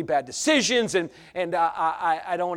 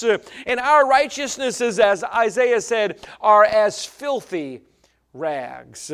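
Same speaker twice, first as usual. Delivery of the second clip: loud, higher, driving sermon; preaching at full force; 5 semitones higher, 7 dB louder, slower than usual. Acted. Preacher warning the church about emotions, preaching at full force. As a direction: hammer the point to the congregation.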